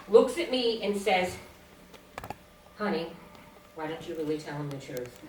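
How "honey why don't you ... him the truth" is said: low, quiet straight-faced delivery; deadpan. Retold or imitated. Husband undercutting his wife before a psychiatrist, deadpan. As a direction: say it deadpan, soft and low, with no hint of joking. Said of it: "deadpan, straight face".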